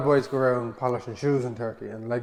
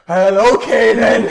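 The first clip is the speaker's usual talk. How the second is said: slow, louder, laughing okay then; laughing resignation; 7 semitones higher, 11 dB louder, slower than usual. Retold or imitated. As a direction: give in, amused. Giving in reluctantly.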